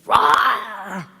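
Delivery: big mock roar from the lion; hammed-up lion roar. Lion roars in told joke.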